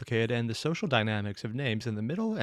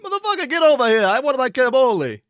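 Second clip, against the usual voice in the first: loud, much higher hammed-up Italian-American mobster shout; mock Italian-American yell; 12 semitones higher, 10 dB louder than usual. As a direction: bellow like an angry Boston Italian-American uncle over a cannoli. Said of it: "while Italian is more of the".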